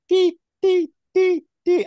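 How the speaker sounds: high, loud beeping "dee, dee"; imitating heart monitor beep. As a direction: mimic an ECG monitor beeping. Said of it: "the heart machine".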